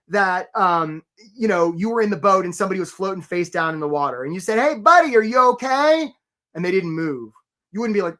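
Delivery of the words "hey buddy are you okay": loud, high-pitched call to a stranger. Acted. Boater calling out loudly to somebody floating face down in the water. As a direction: holler to check on someone at a distance.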